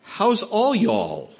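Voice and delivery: slow, drawn-out Texan greeting; Texas drawl. Shown as a demonstration. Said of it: "they say".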